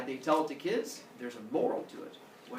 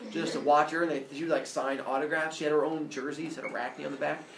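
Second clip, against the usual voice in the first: plain reading voice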